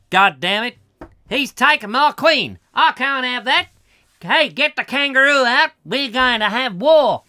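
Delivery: loud, high hammed-up outrage; mock outraged Australian. Woken opponent in Adelaide loses his queen, mock outraged Australian shouting. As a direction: holler indignantly as an Australian chess player.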